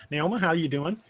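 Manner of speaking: ordinary talk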